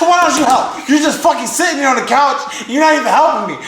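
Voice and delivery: retold scolding. Scolding Trevor for sitting on the couch, exasperated mock rant. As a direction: replay the nagging, fast and high.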